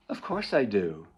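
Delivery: normal talking voice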